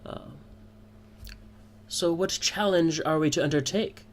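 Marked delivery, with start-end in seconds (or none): 1.9-4.1 higher-pitched drow woman. Acted. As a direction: raise the pitch for a challenging question. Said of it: "the woman kinda asks".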